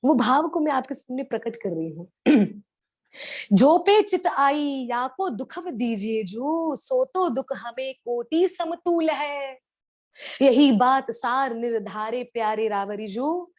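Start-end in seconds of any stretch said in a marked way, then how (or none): 3.5-13.5 lifted, measured poetic delivery; verse recitation. Acted. Preacher reciting a gopi's verse to devotees, slow and lifted. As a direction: recite the couplet slowly, a touch higher at the start.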